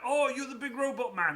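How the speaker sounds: high, loud imitation of the guard; security man. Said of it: "and he was like".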